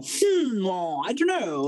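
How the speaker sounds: lightly hammed-up Victor Meldrew musing; a pompous hmm and a weary shrug; posh old grump. Teen voiced like a grumpy old man, musing. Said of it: "he'd go".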